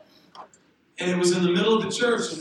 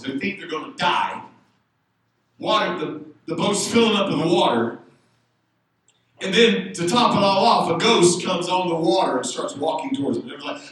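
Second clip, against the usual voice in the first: usual speaking voice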